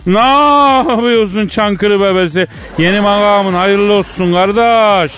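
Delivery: put-on slipping into a broad Çankırı accent on the phone; high, slow Çankırı drawl of a country greeting; phoning the new prime minister in a Çankırı accent. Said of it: "senin de şiven kaydı".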